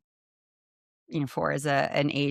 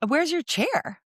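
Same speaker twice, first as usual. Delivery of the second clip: put-on much higher child's voice, innocently asking; curious little girl; 12 semitones higher, slower than usual. Amputee girl asks the toddler back, in a much higher child's voice. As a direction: play a kid's simple, curious question right back. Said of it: "looks at my daughter and she goes".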